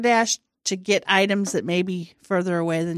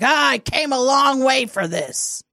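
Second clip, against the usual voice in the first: high, raised mock griping; loudly aggrieved, lightly hammed up; delivery driver's complaint; 7 semitones higher, 4 dB louder than usual. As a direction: imitate a put-out driver. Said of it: "he's like".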